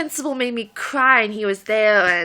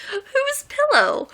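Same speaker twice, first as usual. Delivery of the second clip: puzzled; much slower than usual. Retold or imitated. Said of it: "I'm like".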